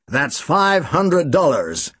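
slow, lightly American statement; understated Chicago drawl on the sum; American accent. Acted. Client states the amount in a light American accent. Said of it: "He spoke with an American accent".

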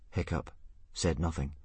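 usual speaking voice

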